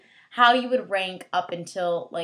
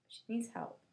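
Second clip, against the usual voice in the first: much softer, muttered aside; quiet aside; 12 dB quieter than usual. Shown as a demonstration. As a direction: drop to a soft aside.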